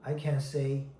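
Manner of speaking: ordinary talk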